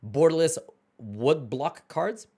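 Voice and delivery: ordinary talk, nothing marked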